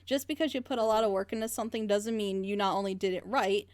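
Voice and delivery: usual speaking voice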